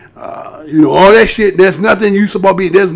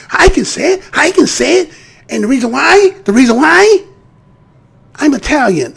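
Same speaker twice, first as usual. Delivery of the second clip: higher, whiny imitation; mock teacher defence; 5 semitones higher than usual. Mocking the teacher justifying herself as Italian, pitched up. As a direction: whine it like a smug excuse.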